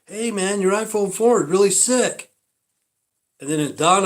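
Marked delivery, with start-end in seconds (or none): 0.1-2.3 high-pitched impressed bro voice; imitated guy gushing, pitched well up; admiring dude. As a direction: compliment it like a stoked dude. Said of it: "And the guy goes".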